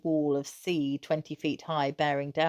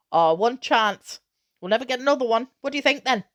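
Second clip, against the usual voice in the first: higher, louder, eager rush of plans; a father carried away by his dream; breathless excitement; 3 semitones higher, 6 dB louder than usual. Acted. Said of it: "breathless with excitement".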